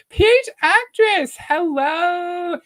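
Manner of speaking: high, loud hello, sung out; excited greeting. Real, not acted. Streamer welcomes a viewer joining live, excited greeting. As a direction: call out a delighted welcome, pitched high.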